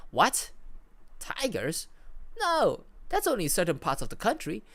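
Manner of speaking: acted bafflement, halting between questions; confused. A narrator's reading. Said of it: "The voice comes back to the walkie-talkie, sounding confused".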